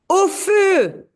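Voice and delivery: high, loud cry of fire; shout of alarm. Acted. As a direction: shout it as a warning to everyone. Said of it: "is shouting".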